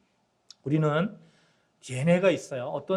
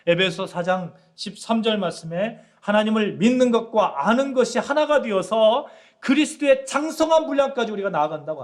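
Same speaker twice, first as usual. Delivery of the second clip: louder, higher preaching build; full-force preaching; 6 semitones higher, 5 dB louder than usual. Acted. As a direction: proclaim it to the congregation with fire. Preaching climax. (설교 절정에서 회중에게 힘차게 외치듯)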